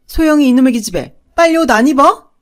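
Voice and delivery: angry scolding. Acted. Mother yells at younger daughter to get dressed, fast furious scolding. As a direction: snap at the dawdling child, fast and loud. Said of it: "동생한테 화를 냈어요".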